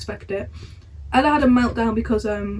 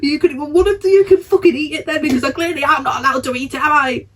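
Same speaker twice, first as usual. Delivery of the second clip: high, loud, bitter sarcasm; sarcastic tantrum; 4 semitones higher, 5 dB louder than usual. Retold or imitated. Fuming cadet walking away from the others, loud sarcastic jab.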